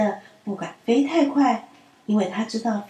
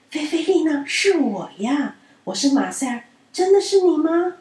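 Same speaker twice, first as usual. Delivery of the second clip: higher and louder, delighted; loud joyful call; 6 semitones higher, 4 dB louder than usual. A narrator's reading. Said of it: "它高声地说". (高声惊喜地喊)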